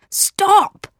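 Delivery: high, sudden cry to halt; urgent high-pitched exclamation; cried out. Acted. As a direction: call out to break up a fight. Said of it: "cried Abbott".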